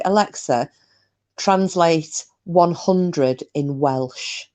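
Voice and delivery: slow, deliberate dictation; slow voice command. Shown as a demonstration. Telling a viewer what to ask Alexa, slowly and deliberately. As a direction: spell out the command word by word, slowly.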